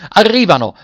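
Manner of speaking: high, loud cry of joy; joyful shout. A narrator's reading. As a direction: echo the news in a jubilant yell. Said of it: "ripeté con un grido di gioia il capitano".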